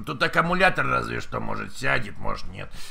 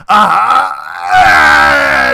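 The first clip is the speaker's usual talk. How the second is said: mock arousal; 4 semitones higher, 14 dB louder, much slower than usual. Mock sex moaning on prank call.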